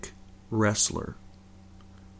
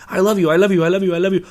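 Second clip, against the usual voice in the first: loud gushing in a bad Cuban accent; mock Cuban accent; 8 semitones higher, 6 dB louder, faster than usual. Fighter to knocked-out opponent, a loud mock Cuban accent. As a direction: gush over the beaten guy in a half-hearted Cuban accent. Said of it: "I don't have a good, like, you know, Brazilian or Cuban accent".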